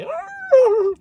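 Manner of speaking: high loud mock neigh; horse whinny impression. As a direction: bray it like a horse. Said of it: "It's horrible impression".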